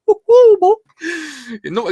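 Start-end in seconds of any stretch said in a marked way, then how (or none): 0.1-0.7 much higher, louder whoop; mock excited cheer; cheering yell. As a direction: whoop like a fan in the stands. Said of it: "anyone sitting there go".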